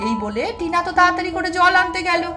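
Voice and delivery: ordinary delivery, nothing marked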